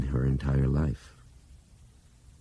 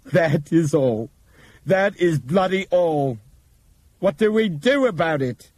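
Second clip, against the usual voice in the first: higher, slow disbelieving echo, mock bitter; incredulous Nick; 13 semitones higher, 6 dB louder than usual. Injured copilot mocking Bob's announcement, incredulous. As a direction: repeat it in pained disbelief. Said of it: "incredulous laugh".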